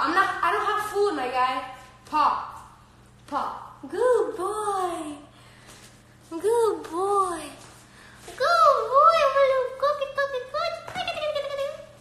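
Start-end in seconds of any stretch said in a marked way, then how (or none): 3.9-7.4 higher, drawn-out pet praise; cooing praise. Real, not acted. Praising the dog. 8.2-11.9 very high pet-talk squeal. Real, not acted. Baby talk to the dog.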